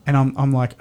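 usual speaking voice

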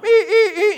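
high-pitched repeated alarm squeals; mock scanner alarm. Security system flags an item, high squealing alarm noise. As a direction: squeal like a security machine going off. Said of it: "there's all sorts of noises".

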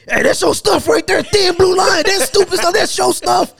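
high, loud pointed yell; mock heckling shout. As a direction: heckle a passer-by at full volume. Imitating friend heckling passer-by.

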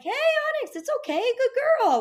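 high, bright happy voice for the dog; praising a good girl, up high and excited; happy dog talk. Shown as a demonstration. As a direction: cheer her on in a high, happy voice. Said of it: "started to throw on really happy, fun talking to her".

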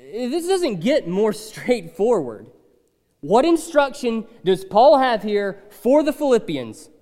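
ordinary talk

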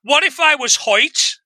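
lightly mocked Northern Irish accent; a hint of Belfast lilt; Northern Irish accent, half-hearted imitation. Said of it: "in this brilliant Northern Irish accent".

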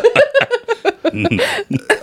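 high-pitched burst of laughing; high laughter. Real, not acted. Laughing at the joke.

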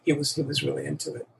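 ordinary talk, nothing marked

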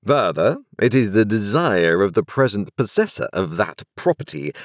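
cool, businesslike lawyer laying down terms; a hint of a dry, formal lawyer; stern lawyer. Jaggers announces property.

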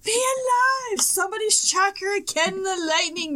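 high, dramatic call to bring her to life; mock revival cry. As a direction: play a hammed-up resurrection scene. Playfully acts out a revival.

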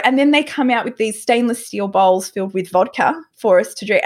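ordinary talk, nothing marked

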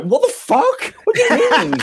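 high, laughing disbelief; shocked friend. Retold or imitated. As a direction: squeal it out through laughter, high. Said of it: "he was like".

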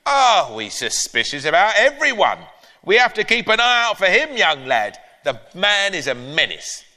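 granny hamming up the danger; Granny warning. Acted.

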